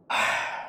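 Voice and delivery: long voiceless sigh; exasperated sigh. Retold or imitated. Worker seeing tar on the mopped floor, exasperated sigh. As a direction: let out an acted sigh of exasperation. Reaction to dirt left on floor.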